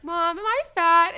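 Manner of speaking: high, loud, worried question; anxious teen. Retold or imitated. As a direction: ask mom nervously. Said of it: "I was like".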